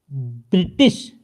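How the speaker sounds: ब्रिटिश drawn out slowly and low; misspelled form read slowly. Shown as a demonstration. Teacher reading a wrong spelling to the class, slow and drawn out. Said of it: "ब्रिटिश नहीं होता".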